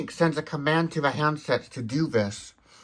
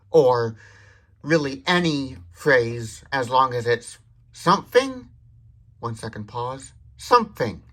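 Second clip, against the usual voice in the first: ordinary delivery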